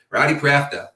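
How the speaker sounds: "Rowdy Crafter" in a Boston accent, R dropped: ROW-dee CRAF-tuh; playful Boston take on "Rowdy Crafter". Shown as a demonstration. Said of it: "point out my accent".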